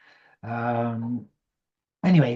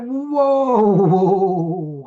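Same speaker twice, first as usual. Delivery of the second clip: much higher, louder whoa, whoa, rearing back; mock outraged whoa; 6 semitones higher, 8 dB louder, much slower than usual. To the program controller, stopping him: high, loud whoa. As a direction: call out a loud high protest. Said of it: "And I'm like".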